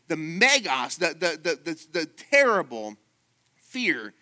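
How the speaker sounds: loud, high, stammering fervor on megas and terrible; full-force preaching. Acted. Preacher to the congregation about fear, at full force. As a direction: drive it home from the pulpit.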